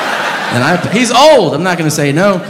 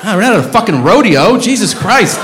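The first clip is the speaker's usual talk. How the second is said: exasperated yell; 10 semitones higher than usual. Acted. Comic to whistling audience member: exasperated yell. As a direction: blow up at the whistler, half joking.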